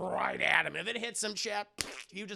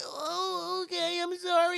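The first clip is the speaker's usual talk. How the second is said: put-on very high, wobbly little boy; a small kid on the edge of tears; 12 semitones higher, 4 dB louder than usual. Kid about to cry.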